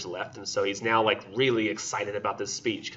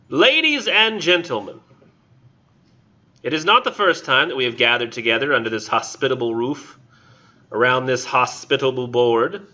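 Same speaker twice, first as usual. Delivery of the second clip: formal speech; 3 semitones higher, 6 dB louder than usual. Shown as a demonstration. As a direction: address the room like a toastmaster. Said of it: "I'm gonna do it like, um, like I'm actually trying to give a speech".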